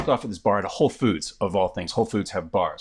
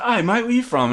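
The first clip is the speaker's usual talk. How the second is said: mock Cockney; 11 semitones higher, 4 dB louder than usual. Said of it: "some fucking Cockney guy in there".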